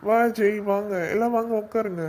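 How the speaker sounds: host greets arriving guests with a loud welcoming call; loud welcome. Acted. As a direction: call them in warmly and loudly.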